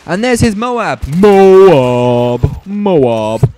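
loud, hyped yell of the killstreak name; excited shout. Real, not acted. Commentator to viewers, hyped as the Moab lands, excited shouting. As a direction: shout it out like the nuke just dropped. Killstreak nuke called in on screen.

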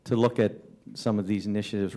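ordinary talk

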